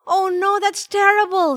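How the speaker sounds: put-on high, upset little girl; high-pitched childish dismay. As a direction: react to awful news as a child. Children dismayed at bad news.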